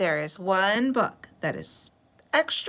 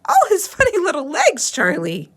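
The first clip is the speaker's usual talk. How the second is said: higher and louder, laughing through it; giggling girl; 8 semitones higher, 7 dB louder than usual. Acted. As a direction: giggle at the funny legs. Lola laughing at beetle.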